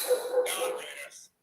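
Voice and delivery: drawn out high and slow, breathy; hammed-up announcer drawl; stretched announcer call. Shown as a demonstration. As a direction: stretch The Raiders way out like a hype call. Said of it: "Should I say it like that?".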